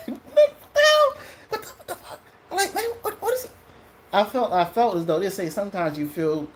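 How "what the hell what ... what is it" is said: high laughing what the hell; incredulous laughing squeal; laughing disbelief. Retold or imitated. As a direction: laugh through the shock, way up high. Reacting to being mocked.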